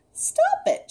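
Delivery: very high little squeak; squeaked protest. A narrator's reading. Starfish protesting at being tickled, squeaked. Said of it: "she squeaked".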